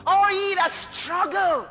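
high, wailing scripture proclamation; loud, drawn-out preacher's cry; impassioned preaching call. Acted. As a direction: call out to the burdened. Preaches at full force.

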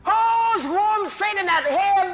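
preacher commanding freedom; bold declaration. Acted. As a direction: declare it with full authority.

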